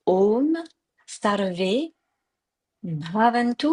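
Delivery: Om Sarve Bhavantu, each syllable said carefully; sanskrit pronunciation. Shown as a demonstration. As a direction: pronounce the mantra slowly and clearly. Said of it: "c'est quand même une bonne prononciation". (prononciation soignée du sanskrit)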